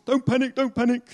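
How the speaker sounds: put-on high, loud panicky cry of an old soldier; Corporal Jones. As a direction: panic while telling others not to. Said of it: "Corporal Jones".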